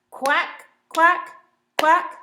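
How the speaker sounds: high, loud quacking like Dottie; loud duck quacks. Retold or imitated. Teacher imitates Dottie's loud yelling.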